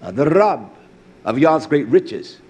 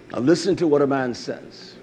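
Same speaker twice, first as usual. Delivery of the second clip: ordinary talk, nothing marked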